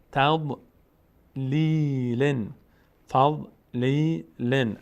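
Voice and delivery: slow drawn-out tajweed chant; Quran recitation. Shown as a demonstration. Teacher reciting the verse for the class. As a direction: recite الم یجعل slowly and evenly.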